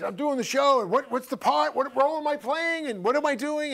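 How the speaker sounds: high, agitated string of questions; fretting actor. Retold or imitated. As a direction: imitate an anxious actor's complaint. Retold actor fretting about his part.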